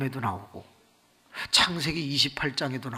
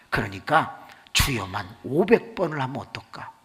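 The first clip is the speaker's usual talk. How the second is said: ordinary delivery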